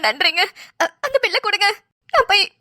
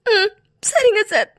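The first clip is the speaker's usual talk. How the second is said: tearful; 2 semitones higher, slower than usual. Acted. Wife hears husband's operation news.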